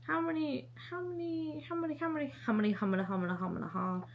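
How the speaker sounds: high "how many" sliding down into a mumble; repetitive searching patter. Pretend. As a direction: chant it over and over, dropping away softly. Hunting aloud for a copy count.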